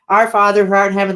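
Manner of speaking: rattling off the prayer fast; rushed prayer. Retold or imitated. Rushing the prayer for a demanding ghost, fast. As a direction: race through it to get it over with. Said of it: "like, really fast".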